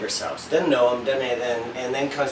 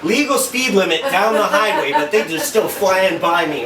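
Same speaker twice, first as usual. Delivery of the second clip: laughing; 5 semitones higher, 6 dB louder than usual. Real, not acted. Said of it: "laughing while recounting drive".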